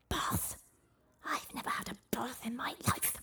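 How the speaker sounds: soft hissing dragon voice; hissing serpent dragon. Acted. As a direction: hiss it like an offended serpent. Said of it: "hissed the dragon".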